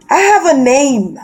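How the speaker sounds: usual speaking voice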